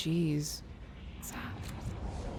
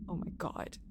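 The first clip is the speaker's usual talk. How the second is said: breathless whisper; 4 semitones lower, 15 dB quieter than usual. Real, not acted. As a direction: whisper it in awe. Reacting to creepy story.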